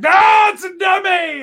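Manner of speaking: high excited shout; crowd shouting. Retold or imitated. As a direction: yell it out like a rowdy crowd greeting you. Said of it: "they're like".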